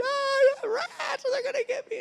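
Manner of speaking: very high imitated scream of fright; mock hysterical shriek. Preacher mimics his wife shrieking about rats, very high. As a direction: squeal it like a terrified wife. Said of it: "just hysterical".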